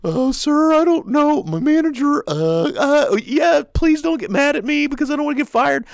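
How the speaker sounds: high, loud, panicky employee voice; hammed-up nervous counter worker; timid clerk. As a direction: plead like a scared cashier afraid of being fired. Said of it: "the person behind the counter is like".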